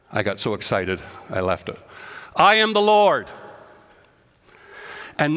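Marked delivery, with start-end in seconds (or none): 2.4-3.3 preaching at full force. Acted. Preacher proclaiming God's words to his congregation, loud. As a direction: declare each phrase like thunder.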